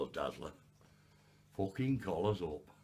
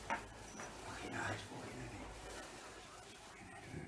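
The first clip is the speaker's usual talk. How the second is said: strained whisper; 9 dB quieter than usual. Real, not acted. The man whispers while straining to lift the heavy bag. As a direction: grunt it out while lifting.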